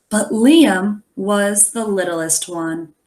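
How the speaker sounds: put-on lively read-aloud to children; story narration. A narrator's reading. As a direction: tell the story to the class, expressive. Reads the picture book aloud.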